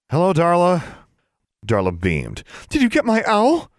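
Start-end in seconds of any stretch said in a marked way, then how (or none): 0.1-0.8 awkward greeting. Acted. Embarrassed boy greets girl. 2.7-3.7 high, bright eager question. Acted. Smitten girl asking her crush, very high eager voice. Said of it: "she asked eagerly".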